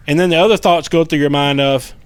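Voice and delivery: ordinary talk, nothing marked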